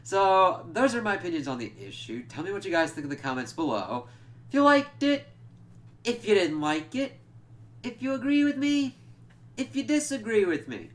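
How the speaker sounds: a bouncy host wrapping up the video; upbeat outro. Acted. Channel sign-off.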